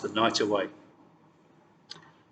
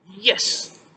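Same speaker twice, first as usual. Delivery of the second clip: loud, high triumphant shout; queen cheering her victory; 7 semitones higher, 4 dB louder than usual. A narrator's reading. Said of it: "shouted the queen".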